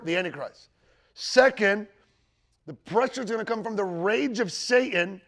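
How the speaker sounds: loud, slow, driving sermon delivery; slow, loud pulpit proclamation; full-force preaching. Real, not acted. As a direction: preach it out to the congregation.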